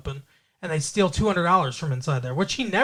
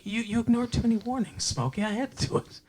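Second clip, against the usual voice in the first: calm, matter-of-fact gangster explaining himself; reasonable tone; 6 semitones higher, 5 dB quieter than usual. Retold or imitated. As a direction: make the threat sound perfectly sensible. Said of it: "he sounds so reasonable".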